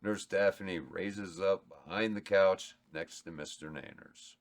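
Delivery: ordinary talk, nothing marked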